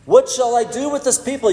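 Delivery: a fed-up leader throwing up his hands; exasperated. Retold or imitated. Pastor voicing Moses crying to God, exasperated. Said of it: "hear some exasperation in his voice".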